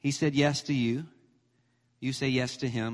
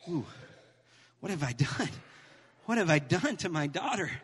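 normal talking voice

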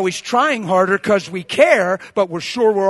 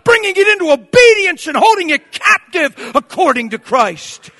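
loud, raised-pitch rebuke from the pulpit; full-force preaching; 12 semitones higher, 11 dB louder than usual. Real, not acted. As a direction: confront them with forceful preaching.

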